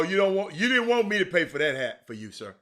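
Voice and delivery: normal talking voice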